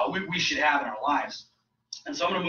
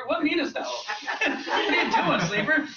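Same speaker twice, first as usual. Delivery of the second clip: much higher, laughing through the words; giggling mock rebuke of a dozing listener; laughing mock scold; 11 semitones higher, 3 dB louder than usual. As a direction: tease a sleeping churchgoer while cracking up. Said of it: "I'm like".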